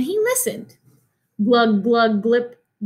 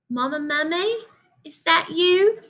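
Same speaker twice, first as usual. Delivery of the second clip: put-on small child calling; 6 semitones higher than usual. Baby calls into the cave.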